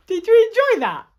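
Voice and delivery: very high, sing-out enthusiasm; real bubbly, high-pitched farewell; excited sign-off. Real, not acted. Signing off brightly to child listeners.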